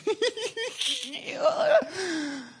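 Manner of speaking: high giggling laugh; high giggle. Retold or imitated. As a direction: giggle helplessly. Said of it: "he's just like".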